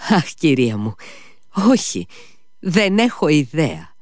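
nervous impatient cry. A narrator's reading. Mitya cuts off the lady, nervous and impatient. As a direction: snap it out nervously. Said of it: "φώναξε νευρικά και ανυπόμονα".

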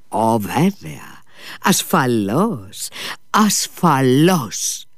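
drawn-out, distracted reassurance; slow, dreamy promise to the clerk; slow absent assurance. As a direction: stretch each word as if miles away.

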